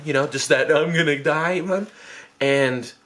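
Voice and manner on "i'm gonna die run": mock terror. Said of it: "that scared run".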